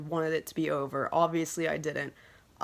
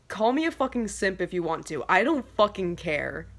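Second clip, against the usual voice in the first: ordinary talk, nothing marked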